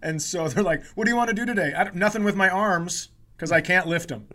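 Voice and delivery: ordinary delivery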